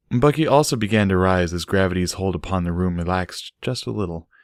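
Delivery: ordinary delivery, nothing marked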